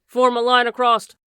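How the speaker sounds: loud commanding shout. A narrator's reading. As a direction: bark the order across the street. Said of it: "he cried".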